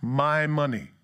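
slow, blunt "Give me my money"; blunt demand. Retold or imitated. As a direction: spell out the demand slowly. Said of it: "He was just saying".

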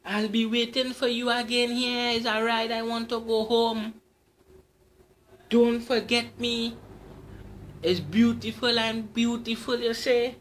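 high, loud teasing call; high loud beckoning call. Acted. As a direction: call after him down the road. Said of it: "she called out to me".

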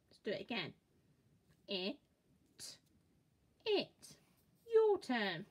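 ordinary talk, nothing marked

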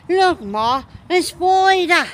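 exaggerated attempt at British; Oh My Gosh. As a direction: imitate a bad British accent, calling out. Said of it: "tries to do the British accent".

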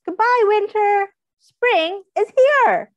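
high, bright cheer for spring; excited welcome. Acted. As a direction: greet spring like good news.

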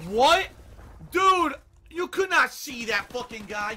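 loud, high-pitched protest; real indignation at an unfair kill; incredulous outrage. Real, not acted. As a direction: yell it in angry disbelief. Player protests being killed.

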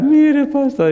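very high, loud mock crying voice; squealed, hammed-up sobbing plea; imitating tearful wife. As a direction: wail it in falsetto, as if overcome with tears. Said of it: "Y empezó a llorar".

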